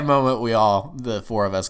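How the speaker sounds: usual speaking voice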